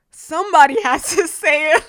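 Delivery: loud and high, laughing through it; laughing, blurt out what everyone thinks; laughing exclamation. Retold or imitated. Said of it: "I'm like".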